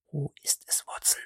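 breathy, voiceless whisper. A narrator's reading. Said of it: "flüsterte Holmes".

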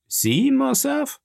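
long, high-pitched disbelief; surprised question. Acted. Journalist to his unexpected friend, slow high surprise. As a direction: stretch it out and lift it high in surprise.

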